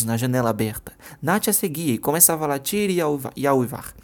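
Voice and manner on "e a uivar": plain reading voice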